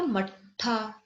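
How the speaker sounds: मट्ठा drawn out slowly with a strong aspirated ठ; slow model word मट्ठा for pupils; careful pronunciation. Shown as a demonstration. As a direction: say मट्ठा very slowly, doubled ठ clear. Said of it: "तो इसका pronounce आपको ध्यान रखना".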